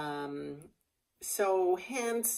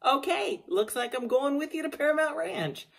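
amused resignation; 10 semitones higher, 5 dB louder than usual. Retold or imitated. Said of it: "I said".

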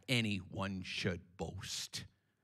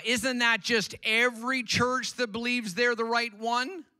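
mock objector; 13 semitones higher, 9 dB louder than usual. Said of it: "Someone might say".

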